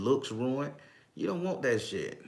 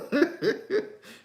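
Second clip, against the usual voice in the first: high-pitched cackling laugh; real laughter; 14 semitones higher than usual. Real, not acted. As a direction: crack up in a high voice. Amused by doom candle suggestion.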